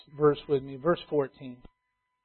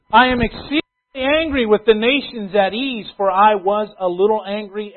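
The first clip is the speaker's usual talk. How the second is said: louder scripture reading; preaching; 4 semitones higher, 7 dB louder than usual. Acted. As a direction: read God's words with weight. Reading God's angry words.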